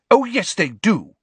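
loud, high angry insistence. A narrator's reading. Luna to Hermione, angry insistence. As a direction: insist hotly. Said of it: "said Luna angrily".

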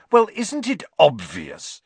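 superior. A narrator's reading. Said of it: "look of maddening superiority".

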